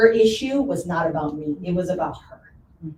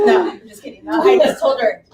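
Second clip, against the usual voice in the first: high, louder, laughing; 7 semitones higher, 6 dB louder than usual. Real, not acted. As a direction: giggle out the retraction. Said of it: "laughing after joke".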